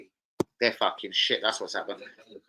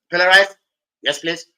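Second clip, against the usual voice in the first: Indian waiter; 3 semitones higher, 10 dB louder than usual. Acted. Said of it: "I was an Indian".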